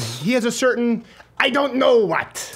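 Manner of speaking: high, arch quoting voice; Steve Martin bit. Retold or imitated. Host quoting a comedian's joke in a high, arch voice. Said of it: "He said".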